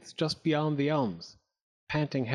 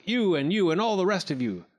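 higher, mildly raised rallying cry; urging call, pitched up; 6 semitones higher, 4 dB louder than usual. A narrator's reading. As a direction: round up every one of them. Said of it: "cried Clack".

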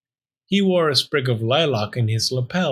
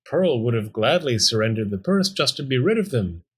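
ordinary delivery

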